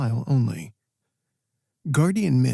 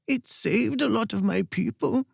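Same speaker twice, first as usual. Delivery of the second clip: put-on a much higher, bright and cheery old woman's voice; a frail old queen chirping about her pride; 9 semitones higher than usual. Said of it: "bright and cheery".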